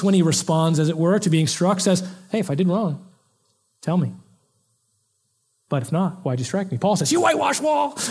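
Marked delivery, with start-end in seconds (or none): 2.3-6.8 measured, unruffled retort with pauses; calm challenge. Retold or imitated. Preacher contrasts Jesus's answer to being struck, calm challenge. 7.1-8.0 high, shouted angry outburst; Apostle Paul losing his temper. Retold or imitated. Preacher acts out Paul snapping at being struck, angry shout. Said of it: "Or he gets mad".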